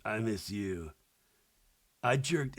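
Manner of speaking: low, quiet mock-drunk boyfriend; soft, low tipsy impression of a boyfriend. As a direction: imitate him mumbling low, a little hammered. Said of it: "a little hammered".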